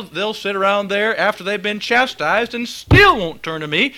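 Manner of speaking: ordinary talk, nothing marked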